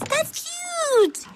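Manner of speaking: very high teasing delight; high-pitched, teasing gush; teasing coo. Acted. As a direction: squeal it high in mock adoration. Teasing about waxing.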